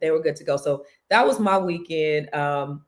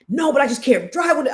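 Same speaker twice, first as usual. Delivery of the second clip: higher, louder protesting yell; retold shout; 8 semitones higher, 4 dB louder than usual. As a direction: shout it back like a fed-up son. Son yelling back during the fight.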